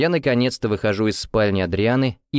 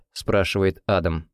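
ordinary delivery, nothing marked

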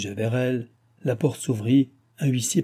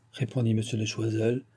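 ordinary delivery

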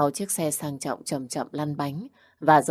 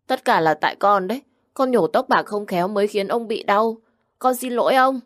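put-on high, louder tearful child blaming himself; crying child; 5 semitones higher, 5 dB louder than usual. Little Vũ tearfully blames himself to grandpa, high and loud. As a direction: sob out the apology.

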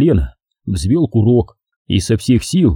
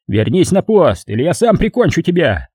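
angry threat; 6 semitones higher than usual. A narrator's reading. Sergeant threatening Lindberg, higher stern threat. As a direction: force the coward back to post. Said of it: "рявкнул фельдфебель".

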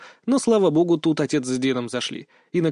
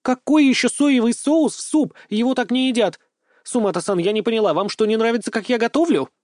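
indignant scolding; 7 semitones higher than usual. Acted. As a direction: scold him for ruining the soup. Sveta scolds at the table.

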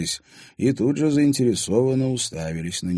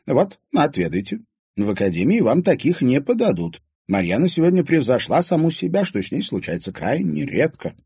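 put-on high, louder old lady's voice; hostess old lady; 9 semitones higher, 5 dB louder than usual. A narrator's reading. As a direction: offer the pies proudly. Old lady offering pies.

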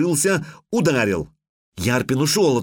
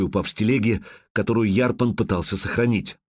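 ordinary delivery

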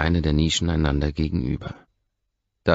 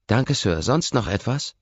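flustered waitress; 8 semitones higher, 4 dB louder than usual. A narrator's reading. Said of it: "haucht die Kellnerin sichtlich verlegen".

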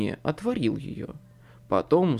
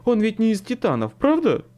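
higher, louder nervous question; jittery man, raised voice; anxious checking; 8 semitones higher, 5 dB louder than usual. A narrator's reading. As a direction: plead for reassurance, pitch climbing. Second man anxiously seeks reassurance.

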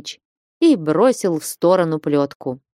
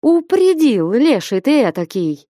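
fond exclamation; 5 semitones higher than usual. A narrator's reading. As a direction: burst out, fondly teasing. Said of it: "добрым, мягким голосом". (радостное, ласковое восклицание)

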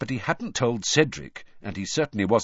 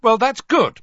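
high, loud sarcastic outburst; loud, losing temper; 12 semitones higher, 10 dB louder than usual. A narrator's reading. Harry snapping at Trelawney's death prediction, loud and angry. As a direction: burst out hotly with raised voice. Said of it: "said Harry loudly, his temper getting".

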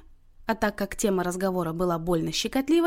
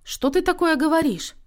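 higher-pitched, shocked reproach; reproachful; 8 semitones higher, 3 dB louder than usual. A narrator's reading. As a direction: take your mother to task. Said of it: "упрекнула её Тоня". (с упрёком, повысив голос)

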